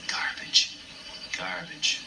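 imitating Jim's whispered, breathy "garbage"; quiet mutter. Jim muttering to himself over his own puppet work, whispered. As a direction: breathe it under your breath, voice unused. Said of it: "I heard him quietly say".

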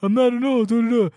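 high, loud mock protesting; flustered denial. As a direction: babble denials in an exaggerated high voice. Imitates newcomer's flustered denial of alt.

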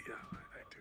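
sheepish near-whisper. Retold or imitated. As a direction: admit it sheepishly under your breath. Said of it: "I kind of sheepishly go".

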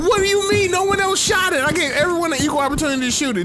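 high, loud fake-innocent protest; hammed-up innocent denial to the angry teammate; mock innocence. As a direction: play dumb, all wide-eyed. Denying blame for stolen points.

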